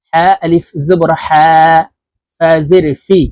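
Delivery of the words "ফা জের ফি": usual speaking voice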